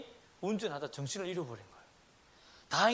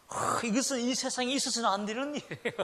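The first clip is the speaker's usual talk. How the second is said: put-on high, whiny protest of a spoiled kid; mock outraged child; 6 semitones higher than usual. Preacher mimics a child whose snack was taken, mock outrage. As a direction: play the wronged older sibling, over the top.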